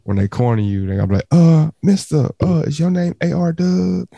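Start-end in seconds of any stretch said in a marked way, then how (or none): 1.3-4.1 high, slow, coy put-on voice; funny boy impression. Imagining guys in Atlanta cornering his friend, high coy voice. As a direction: sweetly ask his name, much higher and drawn out. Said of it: "Whoa, pause".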